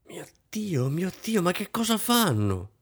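high, hushed, anxious exclamation; agitated murmur. A narrator's reading. Hostess alone at the window, waiting for guests, agitated undertone. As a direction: fret under your breath, pitched high. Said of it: "sussurrò la signora Kampf agitata".

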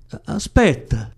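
stammered, high, loud cry of aspetta; near shout. A narrator's reading. Woman near shouting at her departing lover. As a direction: cry out for him to stay, catching on the first A. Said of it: "aveva quasi gridato".

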